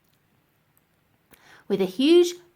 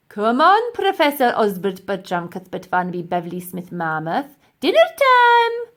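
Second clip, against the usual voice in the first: loud sing-song call; calling the mammoth; 7 dB louder than usual. Acted. Professor calling the mammoth to dinner, loud coaxing call. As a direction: call it out like feeding a pet.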